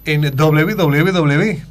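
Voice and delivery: slow, drawn-out radio announcer. Shown as a demonstration. Guest gives out his website to viewers, announcer voice. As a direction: read it out like a locutor, stretching each word. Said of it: "te lo digo con voz de locutor".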